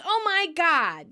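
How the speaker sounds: high, drawn-out exclamation; Monica, relieved greeting. Retold or imitated. Monica seeing her missing brother enter, excited. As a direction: imitate an overjoyed greeting, stretched and high. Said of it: "the girls excitedly say hellos".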